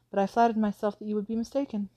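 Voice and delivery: ordinary delivery, nothing marked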